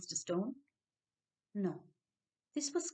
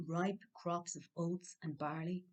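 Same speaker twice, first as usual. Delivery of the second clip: plain reading voice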